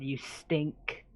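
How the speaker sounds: very soft, low sneer; gruff half-orc voice. Acted. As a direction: hiss the insult quietly. Half-orc character insult.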